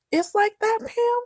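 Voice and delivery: high, hurt Rich; wounded Rich. Retold or imitated. Rich to Pam, betrayed, high and hurt. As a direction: pitch it up in wounded disbelief. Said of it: "Rich was like".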